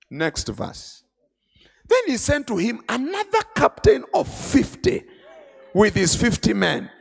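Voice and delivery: usual speaking voice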